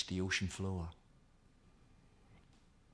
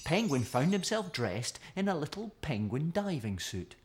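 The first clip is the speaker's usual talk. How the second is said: ordinary delivery, nothing marked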